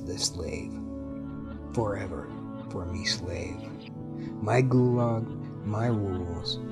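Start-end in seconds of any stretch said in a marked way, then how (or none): none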